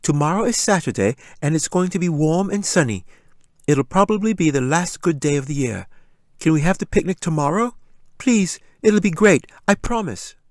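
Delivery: put-on high, eager child begging; pleading little girl. Little girl begging her dad, high and pleading. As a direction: coax dad with a bright plea.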